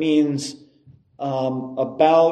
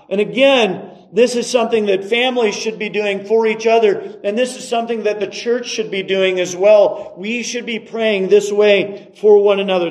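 raised, insistent sermon voice; emphatic preaching; 6 semitones higher, 4 dB louder than usual. Acted. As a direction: drive each point home to the congregation.

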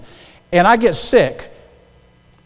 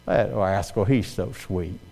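soft, lower fond imitation of his wife; mock wife voice; 9 semitones lower, 11 dB quieter, slower than usual. Husband imitating wife's fond reaction, soft and low. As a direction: coo it like a charmed wife. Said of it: "Becky looks at that and goes".

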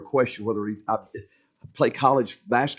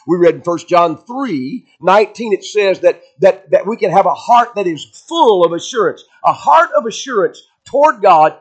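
raised, ringing pulpit voice; preaching; 6 semitones higher, 6 dB louder than usual. Acted. Preacher proclaiming scripture to the congregation, full force. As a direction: preach it out to the whole church.

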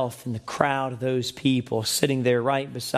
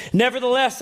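"nevertheless" lifted high and loud; emphatic nevertheless; 12 semitones higher, 5 dB louder than usual. Real, not acted. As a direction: lean hard into "nevertheless". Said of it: "man, I love that phrase".

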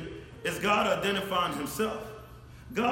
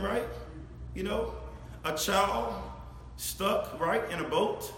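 ordinary talk

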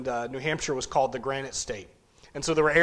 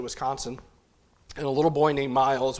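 normal talking voice

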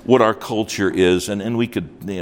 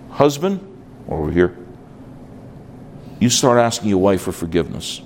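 usual speaking voice

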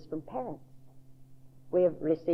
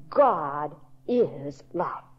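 slow emphatic; 2 semitones lower, much slower than usual. Real, not acted. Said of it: "The most emphatic declaration".

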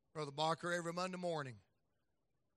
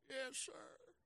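put-on higher, softer timid little boy; small boy voice; 9 semitones higher, 10 dB quieter than usual. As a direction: answer meekly as a child. Voicing his young son.

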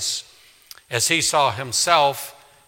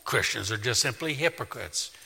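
smug; 5 semitones lower, 9 dB quieter than usual. Retold or imitated. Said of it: "smugly say".

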